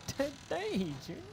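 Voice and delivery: high-pitched mocking echo; laughing, hammed-up taunt; teasing mimicry. Echoes the mock-stutter movie quote.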